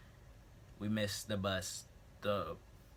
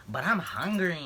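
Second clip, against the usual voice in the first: higher, louder whiny plea; mock whining hungry friend; 10 semitones higher, 8 dB louder than usual. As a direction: whine like a hungry kid. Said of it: "She's like".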